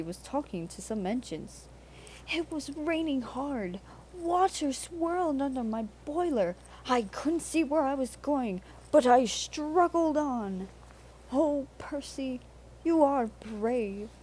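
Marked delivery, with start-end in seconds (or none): 2.3-10.7 lightly raised, boastful little engine; Percy's voice. A narrator's reading. A little engine brags to the others, lightly higher. Percy telling his adventure. 11.3-14.1 higher, slow and impressed; admiring engines. A narrator's reading. The foreign engines admire Percy, higher and awed. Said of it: "admiring engines".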